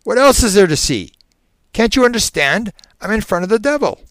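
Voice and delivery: high, loud, exasperated panic; frantic outburst. Acted. Terrified subject answers the hypnotist, frantic. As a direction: protest loudly, pitched right up.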